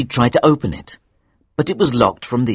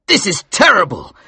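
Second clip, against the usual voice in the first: high, loud shocked cry; acted shock, high and loud; horrified exclamation; 11 semitones higher, 5 dB louder than usual. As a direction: exclaim in horror, pitch raised. Watson reacts to the corpse.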